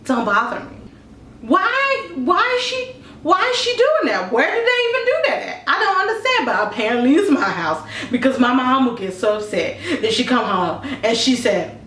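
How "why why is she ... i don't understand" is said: high, baffled disbelief; incredulous. Real, not acted. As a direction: squeak out how absurd it is.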